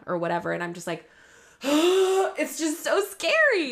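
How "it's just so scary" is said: sharp gasp, slow frightened words; gasp, then scared. Real, not acted. Reacting to the scary scene.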